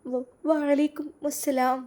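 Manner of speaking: slow, stammered nervous reply; a shy bride's shaky greeting; nervous stutter on വ-; trembling. Acted. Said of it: "അവൾ വിറച്ചുകൊണ്ട് പറഞ്ഞു".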